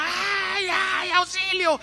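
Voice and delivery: high, slow wailing cry for help; imitated wife screaming. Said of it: "la señora gritaba".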